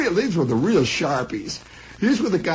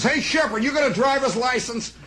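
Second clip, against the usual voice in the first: high boss voice calling out; 9 semitones higher than usual. Retold or imitated. Mister Moss calling the clerk, high brisk voice. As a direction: call the kid over.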